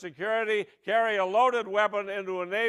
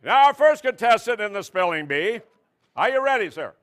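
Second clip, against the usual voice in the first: loud, upbeat game-show host; quiz host announce; 5 dB louder than usual. Pretend. Host opening the mock spelling bee, loud announcing. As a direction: announce it to the hall.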